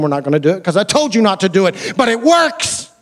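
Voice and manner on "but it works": shouted high, punching works; preaching shout. Real, not acted. Preacher to congregation, insisting it works, shouted high. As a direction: drive it home to the congregation.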